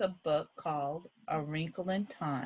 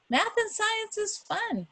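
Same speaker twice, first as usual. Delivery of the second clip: high bright sing-song declaration; upbeat teacher selling fun; cheerful pitch to kids. Acted.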